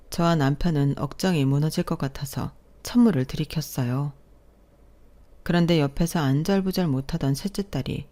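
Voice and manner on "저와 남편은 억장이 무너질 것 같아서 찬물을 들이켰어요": ordinary delivery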